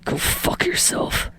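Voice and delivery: slow, soft, unvoiced reflexive spit; spat out. Bakugo snapping back at Deku, spat out softly. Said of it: "Bakugo spits out".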